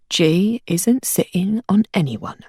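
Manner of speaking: slow, even and reassuring; calmly. Acted. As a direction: settle her down, slowly. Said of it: "Roland said calmly".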